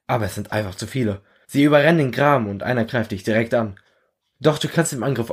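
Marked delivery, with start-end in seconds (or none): none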